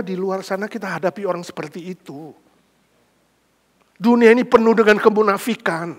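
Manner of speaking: ordinary talk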